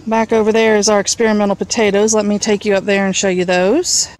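ordinary talk, nothing marked

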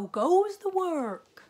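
high, loud, slow bellow from bed; lazy farmer calling. A narrator's reading. Lazy farmer calling from bed to the duck, high loud bellow. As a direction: boom it out like a lazy boss.

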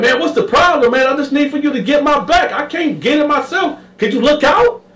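high giggly insistence; Sweet Lo pleading. Retold or imitated. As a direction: laugh it off while pushing. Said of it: "Sweet Lo turned around like".

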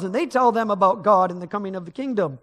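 normal talking voice

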